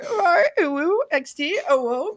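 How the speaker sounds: very high, cutesy uwu squeal. Pretend. Showing off how easily he hits it, cutesy uwu voice. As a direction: put on an exaggerated high uwu voice. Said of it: "hitting that ooh-woo voice".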